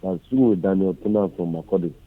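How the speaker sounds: faint phone voice; barely audible caller. Real, not acted. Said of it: "Your voice is too faint".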